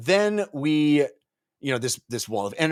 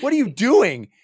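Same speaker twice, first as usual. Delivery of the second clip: indignant yell; 10 semitones higher, 7 dB louder than usual. Retold or imitated. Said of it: "smacks him in the face".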